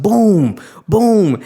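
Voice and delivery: mock clap sounds; imitating clap booms. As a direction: punch each boom like a big clap. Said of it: "it's just like".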